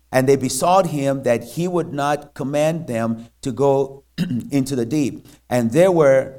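loud, declaiming Bible reading; scripture reading. Acted. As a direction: read the passage out with fervour. Pastor reads scripture aloud.

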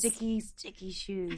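drawn out, soft sultry purr; sexy podcast voice. Shown as a demonstration. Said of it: "This is my sexy podcast voice".

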